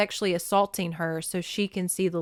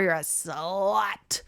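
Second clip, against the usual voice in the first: slow hissed whisper of contempt; mean whisper; much slower than usual. Retold or imitated. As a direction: whisper the insult slowly with venom. Mother insulting daughter.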